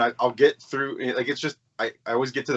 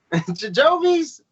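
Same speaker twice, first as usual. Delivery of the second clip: high-pitched mock; 5 dB louder, much slower than usual. Mocking the name high.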